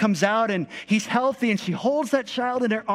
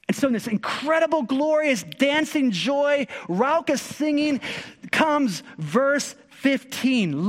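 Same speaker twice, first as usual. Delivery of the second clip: rising exultation; 7 semitones higher, slower than usual. Real, not acted. Exultant build about dancing joy.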